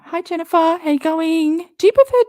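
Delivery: high, loud, sing-song hello; bright high greeting. Acted. Cheerfully greeting a viewer named Jennifer, high and loud. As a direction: greet her like a cheery host.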